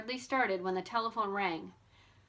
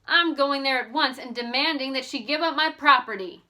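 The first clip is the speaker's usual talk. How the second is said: louder, higher indignant resolve; storming off to demand her property; read-aloud defiant declaration; determined George; 4 semitones higher, 6 dB louder than usual. A narrator's reading. George vows to confront Hooker.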